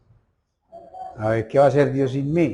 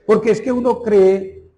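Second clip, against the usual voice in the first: emphatic preaching; 5 semitones higher, 3 dB louder than usual. Real, not acted. Preaching climax.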